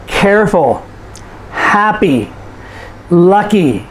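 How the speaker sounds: CARE-ful, HAP-py, LUCK-y, each falling after the stress; first-syllable stress. Shown as a demonstration. Teacher demonstrates adjectives, stressing first syllables: CARE-ful, HAP-py, LUCK-y. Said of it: "we stress the first syllable".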